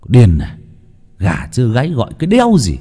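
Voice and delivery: a touch of a groggy, cranky snap; grumpy, irritable. Acted. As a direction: woken too early and cross about it. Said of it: "cất giọng cáu bẩn". (giọng cáu bẩn, ngái ngủ)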